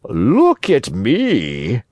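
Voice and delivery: very slow, drawn-out fox voice; slow, self-important sly fox. A narrator's reading. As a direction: stretch each word slyly, near normal pitch. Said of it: "said the fox".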